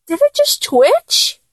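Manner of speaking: high-pitched astonished question; startled disbelief. Acted. Startled by sudden reaction.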